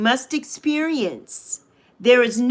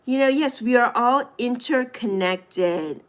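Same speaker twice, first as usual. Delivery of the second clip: ordinary talk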